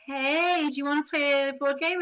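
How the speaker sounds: loud, high, friendly call; eager invitation. Retold or imitated. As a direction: invite strangers to join in brightly. Strangers' invitation to play, retold.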